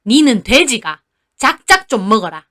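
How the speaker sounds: put-on a touch of an angry old grandmother; grandma scolding granddaughter, mildly voiced. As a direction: snap at the girl to stop eating. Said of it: "할머니가 저한테 화를 내시더군요".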